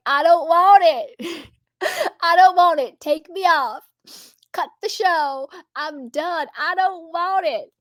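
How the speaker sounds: high, drawn-out mock wail; hammed-up tearful protest, like the man in the meme; imitating crying man. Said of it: "he's crying".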